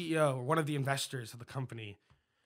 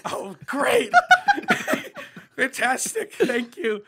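high, loud, laughing through a forced thank-you; laughing fake enthusiasm; 8 dB louder, slower than usual. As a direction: fake delight that cracks into laughter. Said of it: "And I went".

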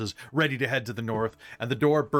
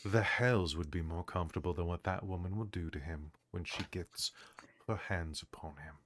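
low, soft, solemn elder; grave low NPC; 5 semitones lower, 11 dB quieter than usual. Acted. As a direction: speak low and heavy like an old sage.

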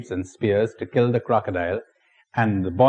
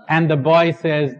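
ordinary delivery

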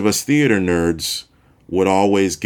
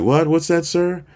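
high, playful radio-host question; mock-confused host asking again; puzzled host; 10 semitones higher than usual. Singer playing radio host asking the fan, puzzled. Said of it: "And he's like".

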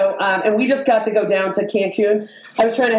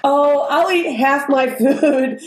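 dainty self-quote; 5 semitones higher, slower than usual. Retold or imitated. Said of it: "And I'm sitting there like".

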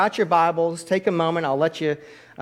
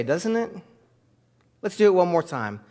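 ordinary talk

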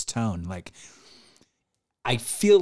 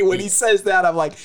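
usual speaking voice

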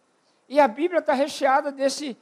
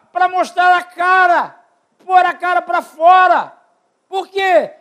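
preached shout; 4 semitones higher, 6 dB louder, slower than usual. Real, not acted. Preaching at full force.

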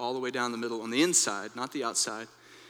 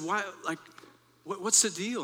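furious; 7 semitones higher than usual. Retold or imitated. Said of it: "I began to get furious".

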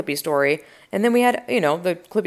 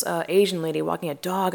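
ordinary talk, nothing marked

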